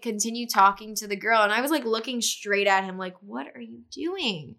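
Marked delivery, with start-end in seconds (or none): 3.2-4.6 soft, drawn-out disbelief; slow hushed 'what on earth'; incredulous. Retold or imitated. Girlfriend staring straight at her oblivious boyfriend, quiet disbelief. Retold pointed stare at boyfriend.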